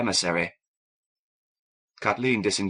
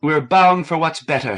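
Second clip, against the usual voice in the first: rallying firmly; 7 semitones higher, 7 dB louder than usual. A narrator's reading. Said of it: "She rallied".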